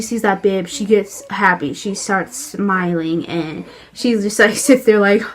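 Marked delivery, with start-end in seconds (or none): none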